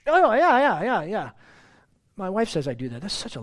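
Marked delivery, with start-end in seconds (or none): 0.1-1.3 much higher, louder mock absent agreeing; distracted yeah yeah. Husband half-listening to his wife, mock absent agreeing. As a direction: rattle off yeahs without listening. Absent-minded reply when checked out.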